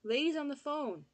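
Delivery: loud, high yell. Acted. Boy announcing the caller, loud high yell. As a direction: shout it across the house. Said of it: "he yelled".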